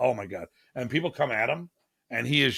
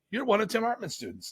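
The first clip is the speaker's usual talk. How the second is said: excited fan; 10 semitones higher, 5 dB quieter than usual. Retold or imitated. Stranger recognizing Ryan.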